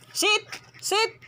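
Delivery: sharp, high dog command. Real, not acted. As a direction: order the dog to sit, high.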